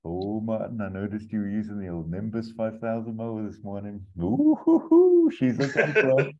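high, put-on voice of the chatty neighbour, whooping at the end; neighbour impression. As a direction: play the nosy neighbour gushing about the mower, then hoot. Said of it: "Keegan's like".

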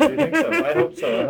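a surprised, delighted laugh running through the words; high, laughing disbelief turning to hope; laughing surprise. Real, not acted. Surprised, hopeful laughing reply.

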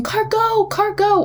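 put-on high, animated two-word model for a toddler; animated play talk. Shown as a demonstration. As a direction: cheer the toy car along, high-pitched. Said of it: "like you heard me do earlier".

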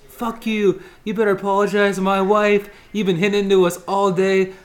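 hammed-up angry yell at a stranger. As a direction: shout it at the golfer who hit into you. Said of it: "the first thing I hear is".

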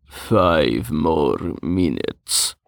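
slow drowsy mumble; sleepy mumble. A narrator's reading. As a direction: mumble it half asleep. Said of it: "he mumbled".

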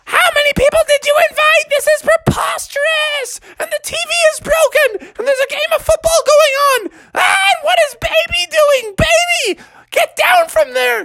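very high, outraged falsetto scolding; frantic high-pitched mother voice; screaming mom. Acted. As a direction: shriek at the child in horror. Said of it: "she screamed".

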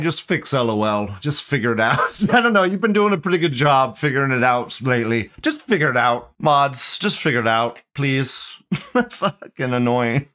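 usual speaking voice

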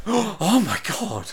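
mock gasp, then a slow, high exclamation; drawn-out, hammed-up astonishment; audible gasping. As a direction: imitate an awestruck filmgoer gasping. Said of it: "never heard so many audible gasps".

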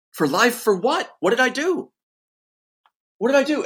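reliving the shock of being told he's locked away; incredulous protest. Retold or imitated. Man retelling his interrogation to the host, incredulous high protest. Retelling disbelief at life sentence.

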